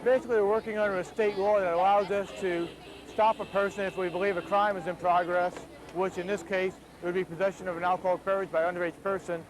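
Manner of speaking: usual speaking voice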